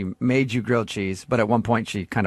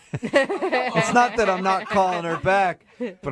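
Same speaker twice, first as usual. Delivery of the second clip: mocking echo; 8 semitones higher, slower than usual. Host mocks the caller's excuse.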